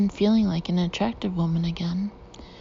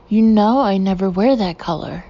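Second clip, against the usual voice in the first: sharp, louder complaint; raised voice; 9 dB louder than usual. Acted. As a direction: let the voice rise in annoyance. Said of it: "The voice rises".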